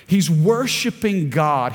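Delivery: usual speaking voice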